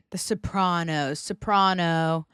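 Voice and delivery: Sopranos and soprano with PRAH; standard pronunciation. Shown as a demonstration. Podcaster repeats the standard way, so-PRAH-no. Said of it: "it's supposed to be".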